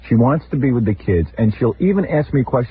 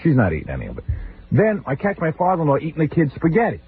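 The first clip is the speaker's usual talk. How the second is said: ordinary talk, nothing marked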